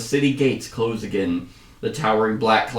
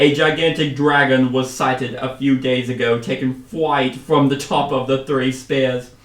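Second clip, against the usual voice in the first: put-on louder commander in a wandering accent; Australian-British accent; 4 dB louder than usual. Commander reporting the dragon sighting, wandering put-on accent. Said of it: "swapping from, like, Australian to British".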